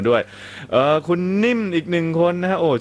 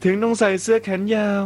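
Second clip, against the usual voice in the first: teasing read; 6 semitones higher than usual. Retold or imitated. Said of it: "บอกว่า".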